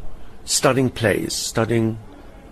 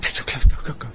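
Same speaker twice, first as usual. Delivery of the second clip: urgent whisper; 3 semitones higher, faster than usual. Retold or imitated. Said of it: "He said".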